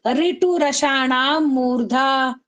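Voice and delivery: very slow, drawn-out sutra chant; slow recitation. Shown as a demonstration. Teacher chants the sutra slowly for students to repeat. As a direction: stretch every syllable for the class. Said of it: "जोर जोर बोलो".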